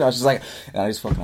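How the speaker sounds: ordinary delivery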